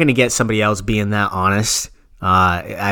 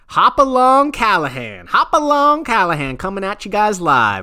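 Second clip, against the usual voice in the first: high, twangy hillbilly DJ voice, much higher; 9 semitones higher than usual. Acted. Radio host hamming it up as a hillbilly DJ for his cohost. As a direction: play a goofy backwoods country DJ. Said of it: "And I'm your host, Moonshiner M H B".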